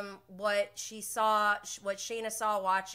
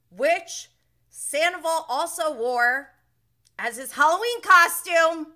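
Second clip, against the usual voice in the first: louder, higher, slowed outrage; indignant emphasis; 7 semitones higher, 11 dB louder than usual. Real, not acted. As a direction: lean hard into the disbelief. Outraged aside about Sandoval's costume.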